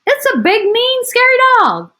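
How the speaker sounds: high, loud frightened squeal; scared burglar. Acted. Burglar warns partner about the dog, high panicked squeal. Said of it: "whispered one burglar".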